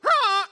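loud high goose squawk; goose attack cry. Retold or imitated. Preacher imitating the goose charging the women, loud squawk. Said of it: "And it just".

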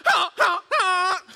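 loud high repeated goose squawks. Retold or imitated. Preacher voicing the goose snapping at women, loud squawks. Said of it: "And it's going".

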